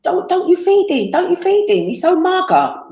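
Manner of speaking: imitating nagging relatives; relatives' reproach. Family scolding a mother for her thin child, mock reproach. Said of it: "my family or my friends would say".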